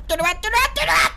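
sudden high panicked cry; real panic, voice jumps up; frantic high plea; panicked yelp. Real, not acted. Panicked at game threat.

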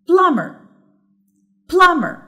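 plumber with PLUM high and loud, then dropping; stress on first syllable. Shown as a demonstration. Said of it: "صوتي بيعلى في أول الكلمة".

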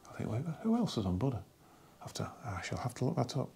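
muttered under breath to himself; a quiet whispered reminder; whispered aside. Real, not acted. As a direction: whisper it as a note to self.

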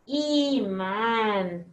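imán slowly, both syllables held long; drawn-out word sounding. Shown as a demonstration. Teacher naming the picture for pupils, drawn-out word sounding. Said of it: "Esta es".

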